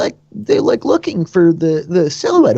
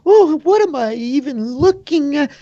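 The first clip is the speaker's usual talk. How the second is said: bewildered; 12 semitones higher than usual. Acted. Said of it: "the handsome woman is like".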